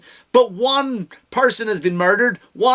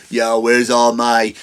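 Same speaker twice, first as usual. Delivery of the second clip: mock comic opener; 10 semitones lower, 4 dB louder, slower than usual. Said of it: "when you do this".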